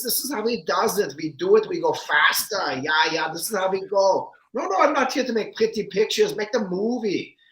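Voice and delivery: put-on high, clipped German-accented director voice; German director impression. Guest imitates German director giving orders, German accent. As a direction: do the German director, a touch hammed-up. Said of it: "he talk like this".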